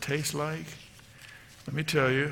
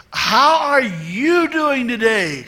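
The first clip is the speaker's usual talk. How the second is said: drawn-out, high, loud "How are you doing today"; stretched words at raised volume; slow loud greeting; 9 semitones higher, 9 dB louder, much slower than usual. Retold or imitated. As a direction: spell it out slowly for an elderly listener. Greeting repeated slowly for elderly woman.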